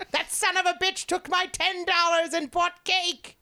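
high indignant cartoon villain. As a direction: grumble like a cheated supervillain. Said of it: "The fucking villain voice".

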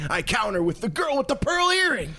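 mock triumphant counter-move. Countering in an imaginary art card game, mock triumphant. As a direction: trump them with playful bravado.

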